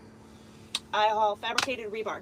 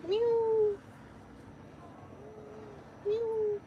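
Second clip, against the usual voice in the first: cat meow; 11 semitones higher, 5 dB quieter than usual. Real, not acted. Meows at her cat.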